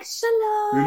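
mock friendly Israeli greeting. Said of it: "it's not like".

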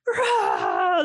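raging roar. Retold or imitated. Mother recalls exploding at her laughing daughter, mock rage roar. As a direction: erupt like a volcano in one burst. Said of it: "like this raging volcano".

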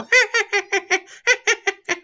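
very high puppet giggle; the tickled doll's laugh; Elmo giggle. Retold or imitated. Showing what the tickled doll does, a high giggle. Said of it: "and he'd go".

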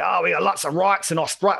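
imitation Australian prime minister; put-on Australian accent. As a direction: do a broad, hammed-up Australian accent. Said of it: "This is my Australian accent".

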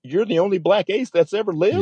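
high-pitched, marvelling disbelief; retold amazement. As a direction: replay being amazed, voice lifted high. His own awed look at the ace.

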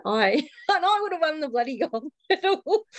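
high-pitched, laughing through the words. Real, not acted. Guest joking about drinking for Australia, laughing. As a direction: crack up while saying it.

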